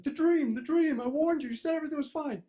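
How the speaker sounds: shrill accusing. Retold or imitated. Shrill reminder to friend.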